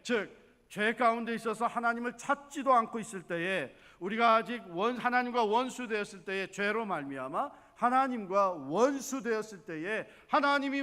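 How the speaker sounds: loud, rising sermon cry over the congregation; preaching at full force. Acted. Pastor preaching loudly to the congregation, fervent. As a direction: preach it out to the pews.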